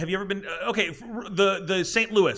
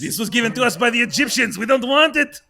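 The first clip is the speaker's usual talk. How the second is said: hammed-up French accent, louder; bad French accent; 7 semitones higher, 8 dB louder than usual. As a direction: overdo a French accent for laughs. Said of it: "That's a bad French accent".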